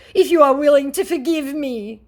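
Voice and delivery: high, loud put-on scammer; hammed-up scammer plea; mock scammer voice. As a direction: plead it in a silly voice. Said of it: "said my scammer".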